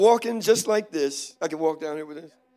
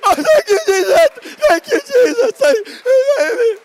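very high, loud, tearful shouts of thanks; hammed-up crying out in overwhelmed relief; ecstatic wailing; 11 dB louder than usual. Finally chosen man thanking Jesus, ecstatic wailing. Acts out his overwhelmed reaction.

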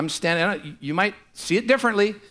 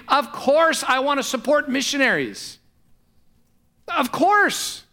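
rising higher with each "of course"; building excitement; 7 semitones higher than usual. Real, not acted. Preacher listing hopes to congregation, building excitement. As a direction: climb in pitch and energy.

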